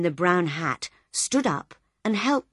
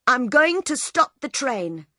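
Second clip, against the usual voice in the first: louder, raised determined voice; 6 semitones higher, 4 dB louder than usual. Acted. Bill standing up before everyone, loud. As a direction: declare it for all to hear. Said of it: "he said loudly".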